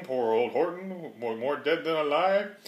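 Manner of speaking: ordinary delivery, nothing marked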